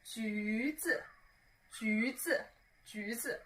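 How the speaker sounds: rounded-lip 橘子, quiet and careful; pouted lips. Shown as a demonstration. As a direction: pout a little as you say 橘子. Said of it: "you need to pout just a little bit".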